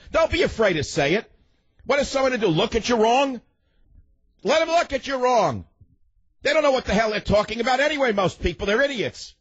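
very high-pitched, worked-up rant; indignant exhortation. Real, not acted. Radio host goading timid listeners to speak up, high-pitched indignant rant. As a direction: urge them on in high, scornful exasperation.

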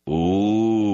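usual speaking voice

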